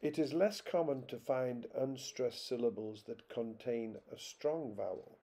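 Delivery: normal talking voice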